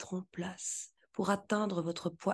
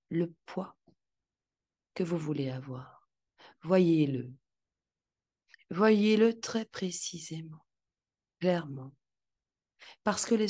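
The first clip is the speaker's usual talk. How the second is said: guided visualization. Acted.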